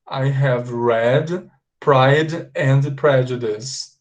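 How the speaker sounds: very slow model sentence, read like "red"; read with open E. Shown as a demonstration. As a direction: spell it out slowly, open eh in read. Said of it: "pronunciar com som de E aberto". (frase bem devagar, read com E aberto)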